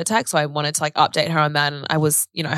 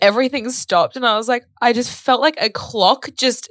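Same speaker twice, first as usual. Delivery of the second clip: high, halting voice on the edge of tears; real anguish; 6 semitones higher than usual. Real, not acted. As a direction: struggle to get the pain out. Reliving news of father's death.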